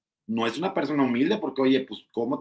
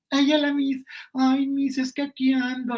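high, sugary suck-up greeting; high mock-innocent voice; mocking fake-sweet voice; 14 semitones higher, slower than usual. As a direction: play the two-faced goody-goody for the teacher. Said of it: "así es Flor Rubio".

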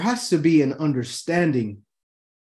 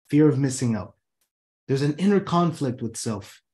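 usual speaking voice

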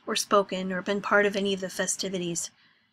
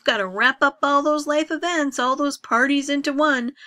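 sarcastic rant; 6 semitones higher, 9 dB louder than usual. Acted.

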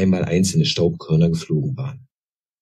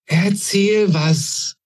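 put-on high, slow, longing child-goblin plea; demanding young goblin; 14 semitones higher, much slower than usual. A narrator's reading. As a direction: stretch it out like a wistful little goblin. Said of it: "forderte er in Gedanken". (hoher, langsamer, sehnsüchtiger Koboldwunsch)

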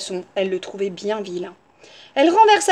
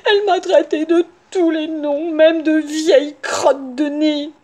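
high, whining, sobbing wolf; hammed-up crying wolf voice; tearful wolf complaint; 5 semitones higher, 4 dB louder than usual. A narrator's reading. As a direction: wail out a list of grievances. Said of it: "répondit le loup entre deux hoquets".

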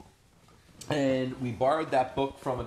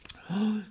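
gasp; 8 semitones higher than usual. Acted. Pasta spills, alarmed gasp.

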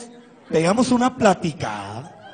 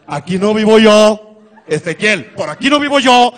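loud protest; 3 semitones higher, 8 dB louder than usual. Retold or imitated. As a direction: insist loudly she doesn't live here. Protesting to ex in car.

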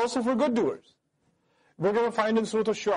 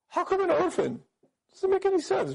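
much higher, scornful mock incredulity; scoffing disbelief; 11 semitones higher than usual. Pagan critics scorning an orphan prophet, high mock disbelief. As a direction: sneer it like the idea is absurd.

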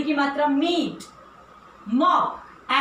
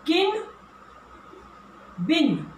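ordinary talk

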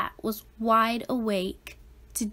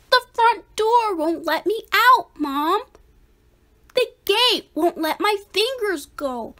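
put-on higher, louder kid whine; Splat whining; 6 semitones higher, 3 dB louder than usual. A narrator's reading. Splat complaining to mom, a higher whine. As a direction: stall and whine. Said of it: "Splat resisting going".

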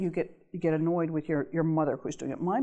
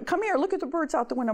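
higher, fast, eager call; eager old mother; 8 semitones higher, faster than usual. Retold or imitated. Daughter imitates her mother calling her to see the birds, higher and fast. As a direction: lightly imitate mom pulling you to the window.

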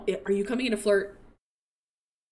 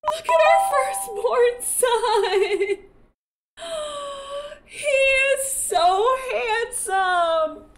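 high, drawn-out cooing; adoring squeal; 10 semitones higher, much slower than usual. Real, not acted. Gushing over newly aged-up baby.

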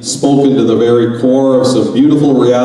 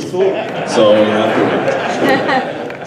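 very slow, patient wait; slow, drawn out; much slower than usual. Shown as a demonstration. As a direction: stretch every word out slowly. Repeats phrase slowly for effect.

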